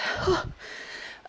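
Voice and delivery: long breathy exhale to recover; tired sigh. Real, not acted. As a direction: let out a winded sigh. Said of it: "I'm getting out of breath".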